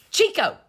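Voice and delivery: high loud imitated parrot scream; mock bird cry; bird squawk. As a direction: screech like the bird. Bird screaming, voiced by owner.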